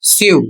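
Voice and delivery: स्यू said as "syoo", y glide before long u; स्यू with a clear s-yoo; model pronunciation. Shown as a demonstration. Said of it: "इसका उच्चारण होगा". (आदर्श उच्चारण: स्यू, "स्यू" की तरह)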